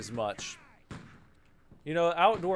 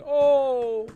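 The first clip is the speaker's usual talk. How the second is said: high, loud, whining mockery; overdone high whiny wail; whiny mock complaint; 10 semitones higher, 10 dB louder, slower than usual. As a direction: whine like a spoiled shopper. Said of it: "they're crying and whining".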